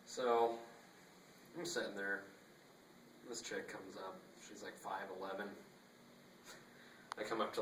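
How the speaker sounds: ordinary talk, nothing marked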